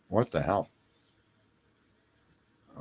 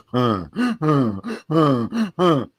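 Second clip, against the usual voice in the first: imitated gasping; 3 dB louder, much slower than usual. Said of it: "She would go".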